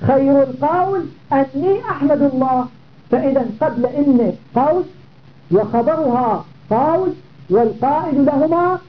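ordinary talk, nothing marked

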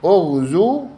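teacher sounding out أغزو with damma; original form modelled. Shown as a demonstration. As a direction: lift and lengthen أغزو as "ughzuu". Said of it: "لأن أصله أغزو".

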